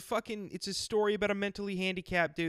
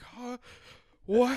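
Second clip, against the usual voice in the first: confused; 9 semitones higher, 4 dB louder than usual. Retold or imitated. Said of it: "And he's like".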